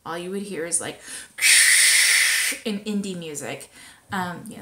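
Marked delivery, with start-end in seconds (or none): 1.0-2.6 hissing, gurgling espresso machine noise. Pretend. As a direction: imitate a steaming espresso machine. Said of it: "that was my espresso machine, um, impression".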